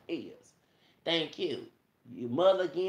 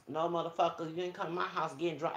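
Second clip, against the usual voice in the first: usual speaking voice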